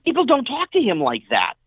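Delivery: high, insistent mock protest from the buddy; protective buddy. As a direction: push the nos up high in disbelief. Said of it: "He goes".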